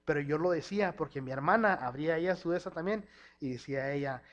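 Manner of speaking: ordinary delivery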